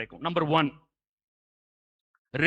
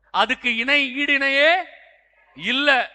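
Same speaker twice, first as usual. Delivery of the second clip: slow, loud, higher declaration; emphatic preaching; 7 semitones higher, 8 dB louder, slower than usual. Real, not acted. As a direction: stretch it out to drive the point home. Preaching at full force. (அழுத்தமான உரத்த பிரசங்கம்)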